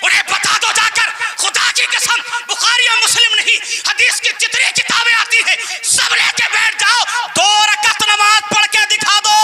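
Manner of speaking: high, ringing sermon challenge; fiery preaching. Real, not acted. Preacher daring his opponents to prove it from books, fiery preaching.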